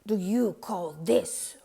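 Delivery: loud angry roar on what. A narrator's reading. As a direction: thunder at the baker. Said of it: "roared the Duke".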